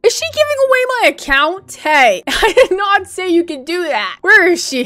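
high, loud, fast alarmed cry; stunned and shouting in disbelief; alarmed surprise. Real, not acted. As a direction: panic that she's giving it away. Shocked account being given away.

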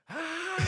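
sudden high yelp of fright; startled waking shriek; retold scream. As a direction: yelp like you just woke to pain. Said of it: "like, screaming".

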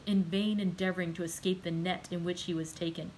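plain reading voice

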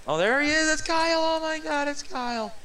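very high, loud squeal of joy; excited squeal. Pretend. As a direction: shriek it like finding a lost puppy. Spots the lost pet Kyle.

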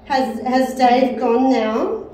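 loud, slow question to the room; calling out. Real, not acted. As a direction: project it slowly to an unseen listener. Calling out to spirits.

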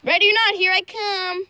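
high sing-song call. Real, not acted. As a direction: call it out to the hiders. Seeker calls out.

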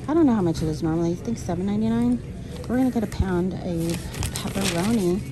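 ordinary delivery

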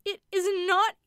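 high, loud, horrified insistence; obstinate horror. A narrator's reading. Rebecca insists the shadow is not from the room, loud horrified insistence. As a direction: insist in stubborn terror. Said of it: "repeated Rebecca with obstinate horror".

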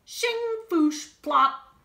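high, loud shovel sound effects; digging noises. Acted. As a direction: voice the spoon digging with playful noises.

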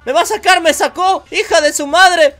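loud high protest as he's thrown out; indignant yelling. Real, not acted. Gamer yelling as a lady drags him from the car, indignant. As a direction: shout it at the lady.

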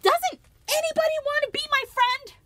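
very high, loud wailing plea; despairing cry. Acted. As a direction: cry out to no one. Lucy despairing.

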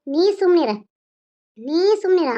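high, loud silencing command; sharp scolding. Acted. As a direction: cut him off firmly. Mother cuts son off angrily.

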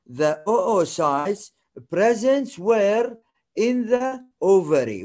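each word drawn out slowly; slow dictation. Shown as a demonstration. Teacher slowly dictating the oocyte definition to students. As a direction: dictate it word by word so they can copy. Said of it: "dictating definition".

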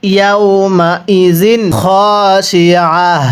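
slow, measured reading to copy; slow recitation. Shown as a demonstration. Teacher leads the students in slow reading. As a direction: read it slowly so students follow along. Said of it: "আমার সাথে মিলিয়ে পড়বেন".

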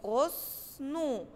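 غصنو stretched slowly and softly; model word. Shown as a demonstration. Teacher shows the class a slow, soft model word. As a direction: read غصنو out syllable by syllable. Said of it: "زي كلمة إيه؟".

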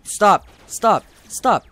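loud, high repeated stop; frantic shouting. Real, not acted. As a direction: yell stop at the attacker. Pleading with attacking enemy.

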